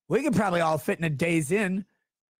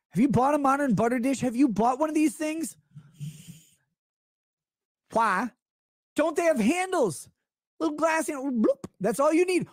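exasperated rant; 6 semitones higher than usual. Real, not acted. Ranting about butter dish handles.

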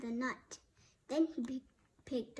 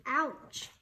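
a louder, hammed-up cry of pain; mild pained yell; 5 dB louder than usual. A narrator's reading. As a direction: yelp it lightly, as if poked. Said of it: "Cork yelled".

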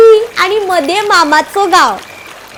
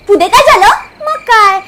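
ordinary delivery

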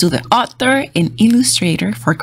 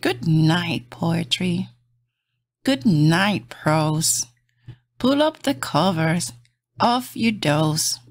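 gentle bedtime storybook read; reading to children; 4 dB quieter, slower than usual. Acted. Reading a bedtime picture book aloud to kids, soft and gentle. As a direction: lull little listeners toward sleep.

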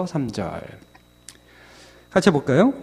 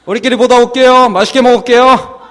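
high, loud, chirpy reply; oblivious cheerful kids; 7 semitones higher, 8 dB louder than usual. Acted. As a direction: play kids happily taking Dad at his word. Imitates oblivious children happily leaving. (눈치 없는 아이처럼 높고 크게 신난 말투)

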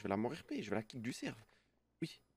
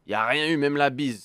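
loud; 10 dB louder than usual. Real, not acted. Exasperated protest.

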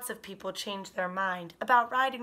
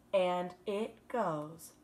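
slow, stretched loop-the-loop words; stretched out slowly; 3 semitones lower, much slower than usual. Acted. Teacher reading to kindergarten, words stretched slow around the loop. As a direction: draw the words out round the loop.

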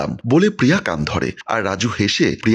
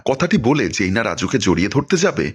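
usual speaking voice

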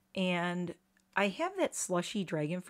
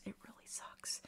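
whisper; 18 dB quieter than usual. Real, not acted. Sad aside about hummingbirds.

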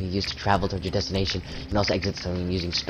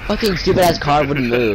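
loud, higher frustrated rant; angry; 7 semitones higher, 9 dB louder than usual. Real, not acted. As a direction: vent at the stupid car. Car blocked his helicopter.

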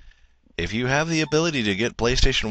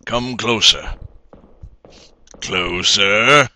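booming echoing villain monologue; 4 dB louder, slower than usual. Acted. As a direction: draw them in like a sinister villain. Villain beckoning, far timbre.

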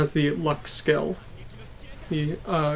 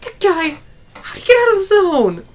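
shocked high shout; 9 dB louder than usual. Acted. Character shocked in battle.

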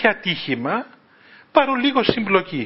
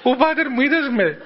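surprised cry; 7 semitones higher than usual. Retold or imitated. Giorgos caught out, surprised.

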